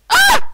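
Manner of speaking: high sudden shriek; startled scream. Real, not acted. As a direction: scream at a jump scare. Jump scare scream.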